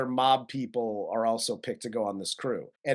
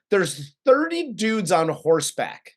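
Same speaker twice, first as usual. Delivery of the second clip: normal talking voice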